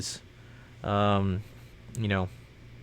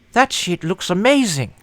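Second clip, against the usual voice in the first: excited; 7 semitones higher, 8 dB louder than usual. Real, not acted. Excited about the ad.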